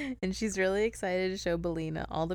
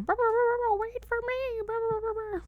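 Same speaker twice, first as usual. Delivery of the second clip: very high squawky chicken voice; clucking hen. Acted. Hen chasing Dorothy to wait, high clucking voice. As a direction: cluck frantically, words squeezed between clucks. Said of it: "Belina's clucking after her".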